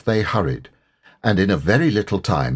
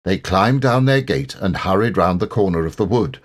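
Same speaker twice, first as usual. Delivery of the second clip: ordinary delivery, nothing marked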